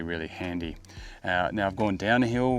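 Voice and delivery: usual speaking voice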